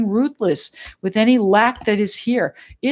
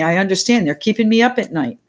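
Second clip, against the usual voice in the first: usual speaking voice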